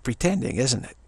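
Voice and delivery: normal talking voice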